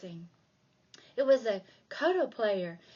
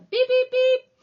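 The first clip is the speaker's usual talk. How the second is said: high, loud, flat beep beep; ping it out like a signal; beeping lights; 14 semitones higher, 12 dB louder than usual. Acted. Narrator imitates traffic lights, high and loud.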